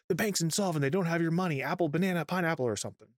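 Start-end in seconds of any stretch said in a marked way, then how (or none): 0.1-1.6 higher, quicker mock relay; last person's relay. Last person in the teller line passing a rumour, high-pitched. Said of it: "the last person goes". 1.6-2.6 last person's relay. Retold or imitated. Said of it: "the last person goes".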